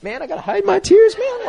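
high-pitched mock tearful plea; retold tearing up. Recalling himself hiding tears during prayer, mock tearful. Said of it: "I was tearing up".

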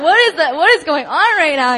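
loud, high-pitched imitation of bewildered alarm; freaking out. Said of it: "Like, freaking out".

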